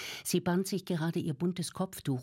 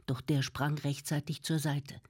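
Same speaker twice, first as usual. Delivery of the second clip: ordinary delivery, nothing marked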